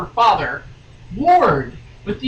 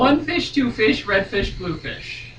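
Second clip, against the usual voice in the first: Dr. Seuss rhyme; 5 semitones higher, 4 dB louder than usual. Pretend. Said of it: "rhyming insanely".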